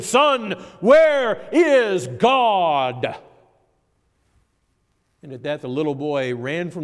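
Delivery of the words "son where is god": loud, high, hugely drawn-out demand; stern raised voice. Acted. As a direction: thunder each word slowly at a cowering child. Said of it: "the clergyman raised his voice even more".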